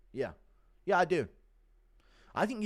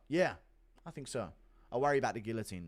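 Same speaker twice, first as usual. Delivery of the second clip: ordinary talk, nothing marked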